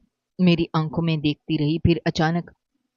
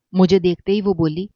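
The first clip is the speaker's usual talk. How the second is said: ordinary delivery, nothing marked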